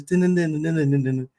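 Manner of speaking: imitating the song's piano hook; hummed piano riff. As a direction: sing the piano melody on "dun".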